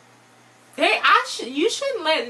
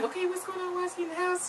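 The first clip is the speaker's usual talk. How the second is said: soft, high, careful voice; hushed questioning; 8 semitones higher, 9 dB quieter, faster than usual. Retold or imitated. Wife asking husband about the man in the house, hushed questioning. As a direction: imitate a wife quietly asking for an explanation.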